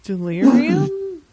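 stunned pause on the name; lightly voiced brother, caught unprepared; taken aback. Dream caught off guard.

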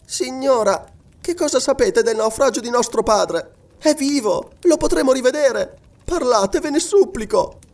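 put-on high-pitched anxious girl's voice; pleading girl. A narrator's reading. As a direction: beg urgently, pitched well up.

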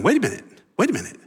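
high, fast, incredulous outburst; a hammed-up what-is-he-doing; baffled protest. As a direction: play the confused disciple who can't believe it. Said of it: "And you're not going".

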